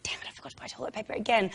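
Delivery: quick whispered grumble to oneself; muttered thought. Retold or imitated. Cursing to self about forgotten toilet paper, whispered grumble. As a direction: whisper it fast, under the breath.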